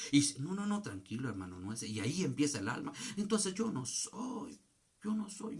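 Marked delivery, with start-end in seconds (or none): 3.2-5.6 slow, high-pitched mild lament; acted self-pitying protest; mock lament. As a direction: draw out the soul's complaint, pitched up. Acting out the afflicted soul.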